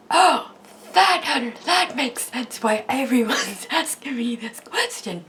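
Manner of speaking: dawning understanding, played up with a laugh; acted sudden realisation. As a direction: replay the moment it clicked. Acting out realisation on hearing own voice.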